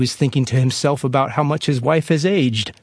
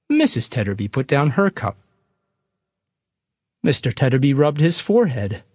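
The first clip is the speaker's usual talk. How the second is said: ordinary talk, nothing marked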